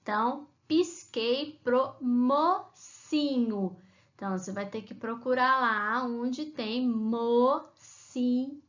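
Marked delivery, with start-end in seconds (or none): 0.7-3.7 "pisquei pro mocinho" very slow, ending mo-ci-nho; syllable by syllable. Shown as a demonstration. Teacher repeating the rhyme for pupils, syllables pulled apart. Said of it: "Onde tá a sílaba". 6.9-8.6 "mocinho" slowly split as mo-ci-nho; syllable by syllable. Shown as a demonstration. Teacher sending pupils to find the syllables, slowly split. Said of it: "Onde tá a sílaba".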